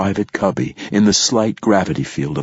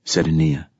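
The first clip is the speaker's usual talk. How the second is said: usual speaking voice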